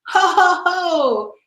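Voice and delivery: high, jolly Santa laugh-call; gleeful ho ho ho. A narrator's reading. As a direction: call it out with glee mid-flight. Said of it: "he always cried".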